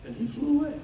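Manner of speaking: ordinary talk, nothing marked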